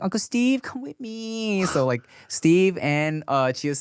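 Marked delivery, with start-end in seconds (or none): 0.0-1.7 put-on high, slow child's plea; sweet toddler asking; little girl voice. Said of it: "She was like".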